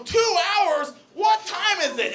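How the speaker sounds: high, startled cry; alarmed question. Retold or imitated. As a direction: blurt it out in mock panic. Panicked question at the party.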